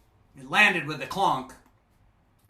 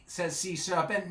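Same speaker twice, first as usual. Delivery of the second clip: usual speaking voice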